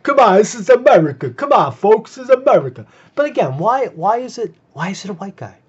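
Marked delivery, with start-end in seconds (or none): 0.0-2.9 loud, higher mock yell of a bigot; loud, higher imitation of a shouting man.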